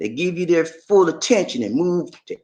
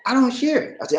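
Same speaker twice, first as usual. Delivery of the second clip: usual speaking voice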